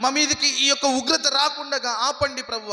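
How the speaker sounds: preacher acting out a desperate lament; imitated wailing plea. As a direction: plead loudly on a high pitch, as if lamenting. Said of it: "బట్టలు చింపుకొని ఏడ్చారు".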